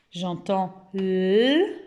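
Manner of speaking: l held very slowly; exaggerated sound. Shown as a demonstration. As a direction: stretch the l sound for the class. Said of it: "on exagère". (son l très étiré)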